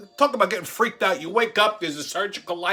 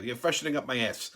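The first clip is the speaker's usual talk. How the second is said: ordinary delivery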